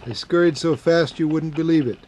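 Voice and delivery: usual speaking voice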